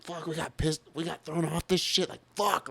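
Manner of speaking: high-pitched re-enacted griping; pissed off. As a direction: vent like an angry stranded band, pitch climbing. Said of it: "They're fucking pissed".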